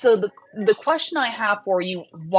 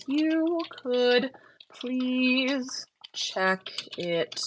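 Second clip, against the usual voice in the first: soft, very slow, drawn-out words; halting, stretched murmur to yourself; talking while typing; 7 dB quieter, much slower than usual. Real, not acted. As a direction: sound out each word absently as you type.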